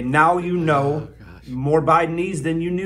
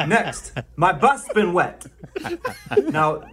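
normal talking voice

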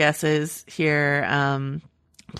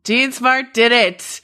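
dramatic echo; 6 semitones higher, 4 dB louder, slower than usual. Real, not acted. Echoing co-host's guess dramatically.

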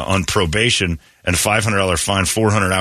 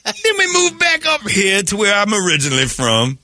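high folksy Southern voice; sweet-talking Southern lawman; drawling Southern sheriff; 7 semitones higher than usual. Acted. Voicing sheriff.

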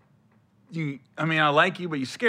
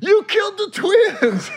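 mock shocked accusation; 3 dB louder than usual.

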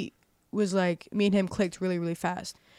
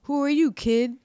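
put-on higher, louder tough old lady; brash older lady; 7 semitones higher, 6 dB louder than usual. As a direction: bark it like a blunt older woman. Older lady voiced in story.

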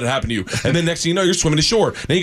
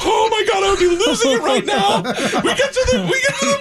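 laughing. Retold or imitated. Teller beside his hooded friend in the car, high and laughing. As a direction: crack up while talking.

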